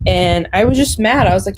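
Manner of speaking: normal talking voice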